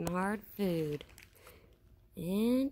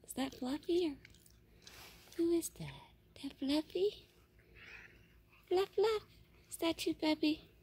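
high-pitched sing-song pet voice; 8 semitones higher, faster than usual. Real, not acted. Baby talk to the animal.

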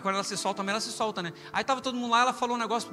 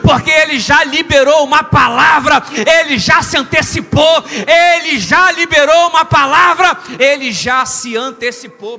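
full-force preaching; 7 semitones higher, 15 dB louder than usual. Real, not acted. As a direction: shout the promise out to the congregation. (pregação pentecostal a plenos pulmões)

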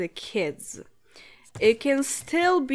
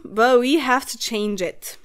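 normal talking voice

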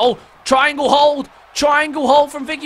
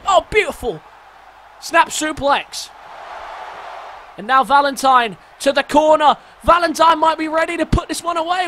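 excited commentary; 5 semitones higher than usual. Real, not acted. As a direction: call the near-fall like it's the finish. Climax of match, rising shouts.

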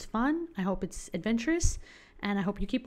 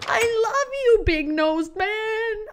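high, loud declaration of love; overjoyed, drawn-out shout; gushing adoration; 13 semitones higher, 8 dB louder, slower than usual. Real, not acted. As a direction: squeal it to a beloved character. Gushing at a game villager.

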